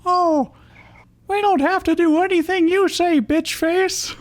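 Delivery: sass back in a tiny squeak; squeaky Emolga. Acted. Emolga mouthing off to Iris in a very high squeak.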